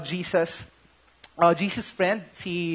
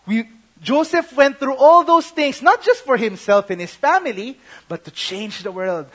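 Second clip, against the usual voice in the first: normal talking voice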